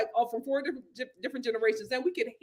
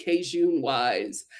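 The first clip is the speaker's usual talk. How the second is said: ordinary delivery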